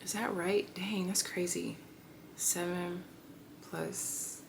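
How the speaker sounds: very soft, talking to yourself; thinking aloud. Real, not acted. Speaker to herself while adding up the years, murmuring. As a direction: murmur it privately as you check the sum. Checking her math to herself.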